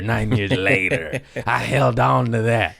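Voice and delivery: usual speaking voice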